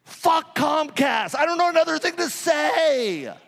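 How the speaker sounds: loud, slow curse, then high and laughing; laughing rant. Real, not acted. Speaker to conference crowd about Comcast, a loud laughing rant.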